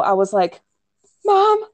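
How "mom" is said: high, loud cry to Mom mid-contraction; panicked yell. Retold or imitated. Telling her mom she's pushing, a high panicked yell. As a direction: yell it out, startled that the body is pushing. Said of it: "And I yelled out".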